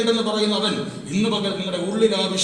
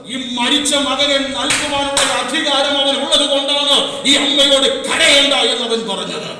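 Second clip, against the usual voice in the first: loud, driving sermon delivery; full-force preaching; 10 semitones higher, 10 dB louder than usual. Acted. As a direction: preach it out to the congregation. (ഉറക്കെ ആവേശത്തോടെ പ്രസംഗം)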